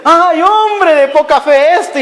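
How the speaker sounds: high, slow, fond teasing; almost laughing. Shown as a demonstration. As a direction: chide lovingly as if amused, drawn out. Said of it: "prácticamente riéndose le dice". (reproche cariñoso, casi riéndose)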